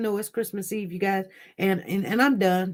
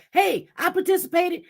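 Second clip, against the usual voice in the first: mocking the mother; 9 semitones higher than usual. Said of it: "She's like".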